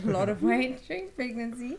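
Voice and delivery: laughing. Real, not acted. Admitting weight gain.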